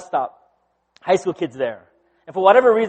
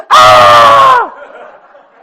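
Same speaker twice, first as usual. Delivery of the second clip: very high, loud mock shriek; freaked-out scream; 17 dB louder than usual. Helen expecting a proposal, hammed-up excited scream. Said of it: "And Helen freaks out".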